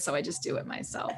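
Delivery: quick embarrassed aside, almost whispered; sheepish murmur. Real, not acted. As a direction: drop to a breathy near-whisper. Sheepish confession trailing off.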